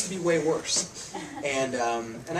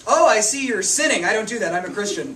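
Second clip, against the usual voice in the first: lightly mocking, a touch louder and higher; 4 semitones higher, 7 dB louder than usual. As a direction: play a smug believer, hammed-up a bit. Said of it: "self-righteous condemnation".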